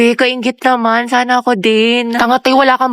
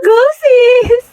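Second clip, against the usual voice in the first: high, loud hype cry; cheering; 12 semitones higher, 4 dB louder than usual. Acted. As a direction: cheer her on. Cheering friend on.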